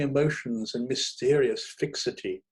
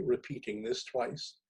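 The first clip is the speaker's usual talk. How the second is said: plain reading voice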